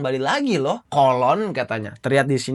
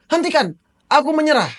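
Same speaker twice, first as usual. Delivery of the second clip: higher, pleading cry to stop; desperate surrender; 11 semitones higher, faster than usual. Acted. Father begging the enemy to stop, high and pleading. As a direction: give up to save your son.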